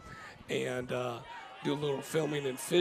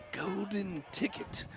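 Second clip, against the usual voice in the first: put-on British accent; 4 semitones higher than usual. Host drifts into a British accent, joking about Willy Wonka. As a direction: slip into a playful British accent. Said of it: "I don't know why I went British".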